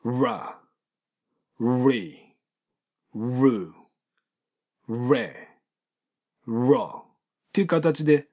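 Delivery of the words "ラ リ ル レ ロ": "ラ、リ、ル、レ、ロ" one by one with a thickened voiced onset; muddy each R of "ラリルレロ" toward a D; voiced, muddied R. Shown as a demonstration. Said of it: "ラリルレロの濁音でどうなるか".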